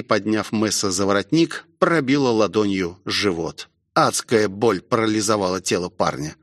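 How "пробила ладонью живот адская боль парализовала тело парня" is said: ordinary delivery, nothing marked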